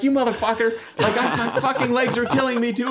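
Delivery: high, exasperated mock yell; yelling at a friend in a wheelchair, mock rage; retold yelling. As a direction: chew out your friend, laughing it off. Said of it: "bawling him out".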